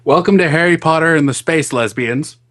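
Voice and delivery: ordinary talk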